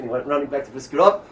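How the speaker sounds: normal talking voice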